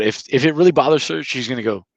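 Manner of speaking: ordinary talk, nothing marked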